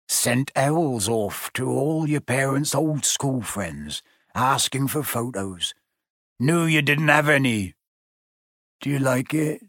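lightly voiced kindly giant, tentative; Hagrid, shyly kind. A narrator's reading. Hagrid explaining his photo album to Harry, shyly. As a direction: offer it shyly, with a hint of the gamekeeper.

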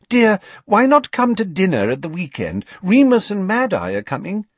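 high, coaxing motherly invitation; Mrs Weasley coaxing. A narrator's reading. Mrs Weasley urges Tonks to come to dinner, high and coaxing. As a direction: coax her kindly, pitched high.

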